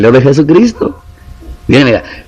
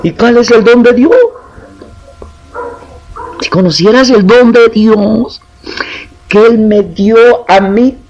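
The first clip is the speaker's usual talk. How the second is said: higher, impassioned preacher voice; fervent preaching; 9 semitones higher than usual. Acted. Pastor exhorts his congregation, fervent preaching. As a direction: preach it out with fervour.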